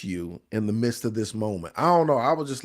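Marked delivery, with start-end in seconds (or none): none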